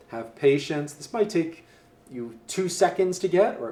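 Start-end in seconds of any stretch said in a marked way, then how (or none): none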